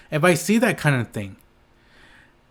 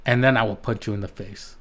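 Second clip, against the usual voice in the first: ordinary delivery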